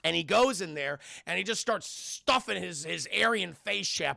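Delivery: usual speaking voice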